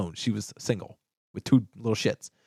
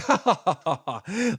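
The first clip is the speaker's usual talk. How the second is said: high-pitched mock belly laugh; imitated laughing; 6 semitones higher than usual. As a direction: imitate his burst of ha-ha laughter. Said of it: "he starts laughing".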